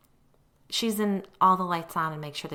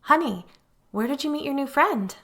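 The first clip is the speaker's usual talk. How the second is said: high, bright forced sing-song; mother masking alarm with a high sing-song; 10 semitones higher, 7 dB louder than usual. A narrator's reading. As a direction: fake cheer to hide fear. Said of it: "in an unnaturally sing-song voice".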